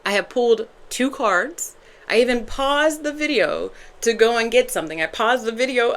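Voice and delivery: normal talking voice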